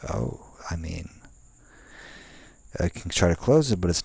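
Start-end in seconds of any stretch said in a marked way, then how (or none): none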